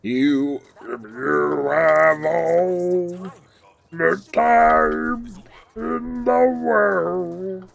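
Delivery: slow, drawn-out crooning; [name] impression. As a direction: croon it lazily like old jazz. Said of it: "not to do a [name] impression".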